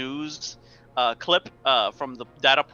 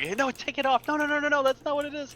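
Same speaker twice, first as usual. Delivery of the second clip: high urgent protesting voice; frantic miming man; 12 semitones higher, faster than usual. Pretend. Older man on the screen protesting, high urgent voice. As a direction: wave them off, insisting. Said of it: "trying to mime something".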